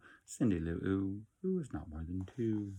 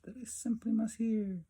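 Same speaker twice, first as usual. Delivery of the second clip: grinning Grinch. A narrator's reading. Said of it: "grinned the Grinch".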